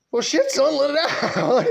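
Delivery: high laughing dad voice; dad giving in and laughing at his son. Retold or imitated. Dad laughing, much higher.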